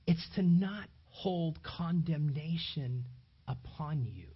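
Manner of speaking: normal talking voice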